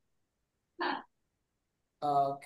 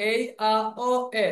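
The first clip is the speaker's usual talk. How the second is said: slow, separated vowel sounds; model for repeating; slower than usual. Shown as a demonstration. Teacher asks students to repeat, slow vowel drill. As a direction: sound out each vowel slowly. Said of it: "বলেন".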